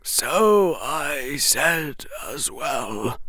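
put-on soft, very slow old headmaster; slow, hushed headmaster voice; Dumbledore. A narrator's reading. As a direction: draw it out quietly, word by word. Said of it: "said Dumbledore".

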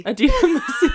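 high, loud laughing name. Real, not acted.

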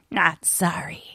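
whispered gravelly celebrity impression. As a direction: rasp it out in a hushed growl. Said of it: "with his gravelly voice".

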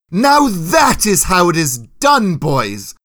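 loud, high, slow proclamation of praise; applauding. A narrator's reading. Knight applauding soldier's win before squires, loud praise. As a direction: crow it to the trainees, dropping on the last word. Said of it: "Lancel applauded".

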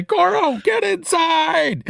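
high, urgent yelled command; urgent shout. Acted. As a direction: yell it at your friend to get in. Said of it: "and say".

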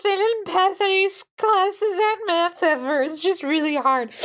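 high, whiny hammed-up crying; mock sobbing. Clerk breaking down over math, a hammed-up high sob. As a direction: wail about math like a child.

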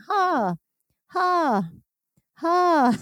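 high-pitched calling out; retold yelling. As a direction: reenact calling a coworker's name from the rooftop. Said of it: "I started yelling".

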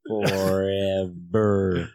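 slow, heavy, doom-laden; ominous drawn out. Pretend. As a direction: stretch it out like a curse. Ominous reply to question.

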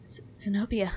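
barely voiced whisper of the name; faint whisper. A waking patient whispers her rescuer's name. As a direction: breathe the name out weakly on waking. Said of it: "she just goes".

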